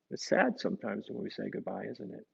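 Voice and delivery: ordinary delivery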